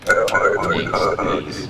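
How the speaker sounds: loud gruff call for the next one. Pretend. Inspector calling the next traveler, loud. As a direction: bark it down the line.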